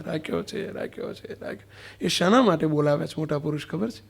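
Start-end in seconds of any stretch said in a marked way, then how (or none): 0.0-0.7 softly intoned રાખ્યો છે; echoed prayer line. Acted. Prayer ending, repeated. 0.7-1.7 રાખ્યો છે whispered, fading away; whispered prayer echo. Acted.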